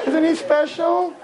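high, slow mock praise with a laugh; amused mock admiration; mock admiring. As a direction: sing it out teasingly. Mocking the young man.

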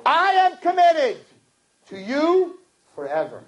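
high, slow fervent preaching. Acted. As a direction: preach it with full conviction. Preaching at full force.

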